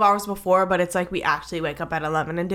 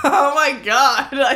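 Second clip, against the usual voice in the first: laughing, higher and louder; 6 semitones higher, 5 dB louder, slower than usual. Real, not acted. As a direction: laugh through the words. Laughing at partner's story.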